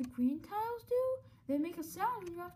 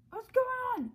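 alarmed; 8 semitones higher, 7 dB louder than usual. Acted. Panicking at the flames.

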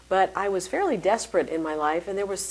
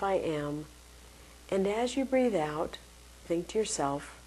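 guided meditation. Shown as a demonstration. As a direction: ease them gently into calm. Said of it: "So here's a very simple technique".

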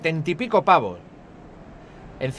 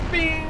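sound effect; 11 semitones higher than usual. Real, not acted. Imitating game sound.

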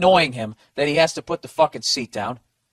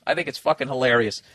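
ordinary talk

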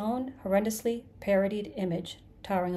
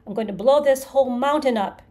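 scream; 3 semitones higher, 9 dB louder than usual. A narrator's reading. Creature vows to destroy everything, screaming. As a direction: start loud and climb into screaming. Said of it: "he screamed".

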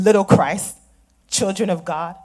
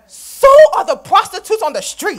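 sharp, higher and louder comeback; bitter retort; 6 semitones higher, 6 dB louder than usual. Acted. Daughter snaps back at her mother in anger. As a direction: throw it back at her with scorn.

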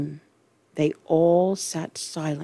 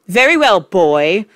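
loud; 3 semitones higher, 7 dB louder than usual. Acted. Headmistress bullies boy.

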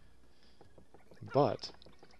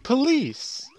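high, loud indignant accusation; outraged fan; 8 semitones higher, 10 dB louder than usual. Acted. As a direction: cry out at an imposter, rising to a shriek. Fan confronts supposed imposter.

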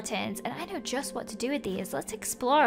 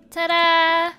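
ordinary talk, nothing marked

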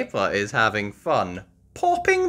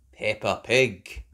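ordinary delivery

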